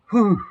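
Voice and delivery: loud, high whew of relief; sighing out. Acted. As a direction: blow it out like a long sigh. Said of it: "Bonnie, sighing out".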